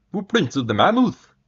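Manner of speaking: high, loud, astonished cry; excited exclamation. Acted. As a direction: call it out in amazement. Berg exclaims at footprints.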